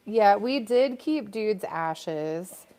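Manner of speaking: ordinary delivery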